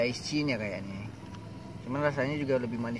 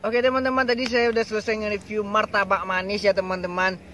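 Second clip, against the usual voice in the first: upbeat vlog outro; 6 semitones higher, 7 dB louder than usual. Acted. Host wraps up the video.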